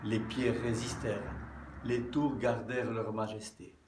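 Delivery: measured poem reading, phrase by phrase with pauses; poem recitation. Acted. Speaker reads listeners an anonymous Holy Week poem, measured recitation. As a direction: recite it solemnly, stressing the openings of verses.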